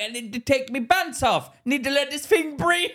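high-pitched, hammed-up Jamaican accent. Co-host impersonating the granddad wanting his pants off, mock Jamaican accent. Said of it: "That's Jamaican ac-, oh, you can't do the Jamaican accent".

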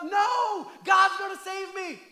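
loud stubborn shout, drawn out; screaming up. Retold or imitated. Man on his roof shouting up at the helicopter, stubborn. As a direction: yell it up at the helicopter, refusing. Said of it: "he screams up".

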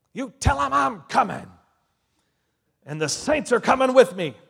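loud, high battle cry; big dramatic shouted proclamation; triumphant shouted warning. Acted. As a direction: roar it out as a returning conqueror. Acted quote of Jesus announcing his coming.

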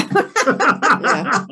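long high cackling laugh; loud staccato laughter. Real, not acted. Laughing at her candle joke.

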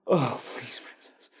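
breathless whispered plea; whispering. Acted. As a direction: whisper it, barely voiced.

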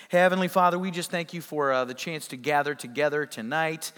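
soft, quiet prayer voice; hushed pastor's prayer, soft and reverent. Acted. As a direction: pray gently to the Lord, hushed. Leads a prayer.

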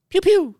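high, loud laser blaster noise; pew pew sound effect. Pretend. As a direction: zap it like a toy laser gun.

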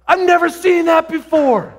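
high, drawn-out amazed cry; imitated freaking out. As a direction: act out overwhelmed astonishment, slowly. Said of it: "she starts freaking out and going".

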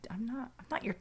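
unvoiced whispered start. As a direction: whisper the refusal. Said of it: "that other voice, it sounded so close to it".

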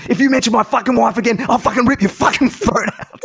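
hammed-up furious threat; retold outburst of a bowler in a mock rage.

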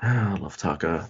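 usual speaking voice

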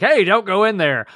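acting out a shouted warning; imitated yell. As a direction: call it out like yelling after someone. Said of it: "yells at Vegeta".

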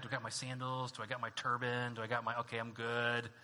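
low, soft, level muttering to oneself; lightly acted checklist under the breath; muttered self-check. Paul checking what he packed for the journey, muttering to himself.

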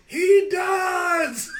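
put-on very high, loud drunken yell; drunk shout. Drunk Maria yelling to the host, loud high shout. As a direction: scream it from the crowd.

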